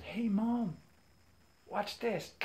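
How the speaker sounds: put-on high-pitched kid calling Mom; showing off to mom.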